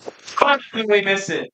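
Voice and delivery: high, loud New Year cheer; excited shout. Real, not acted. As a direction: shout it out joyfully. Calling out at midnight.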